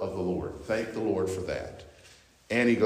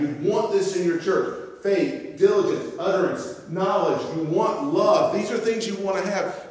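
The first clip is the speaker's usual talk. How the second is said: impassioned preaching; 8 semitones higher than usual. Acted. Pastor voicing Paul's plea to the church, high and impassioned. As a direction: plead with the church like Paul himself. Voices Paul, peak of sermon.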